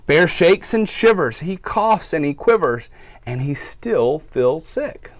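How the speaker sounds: storybook read-aloud for little listeners; reading to children. A narrator's reading. Teacher reads to her class, storytime voice.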